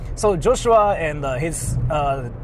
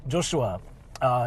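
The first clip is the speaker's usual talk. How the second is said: ordinary delivery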